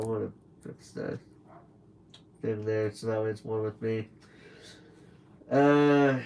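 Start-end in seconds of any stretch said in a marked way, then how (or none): 0.0-4.1 muttered aside. Real, not acted. Aside while adjusting setup.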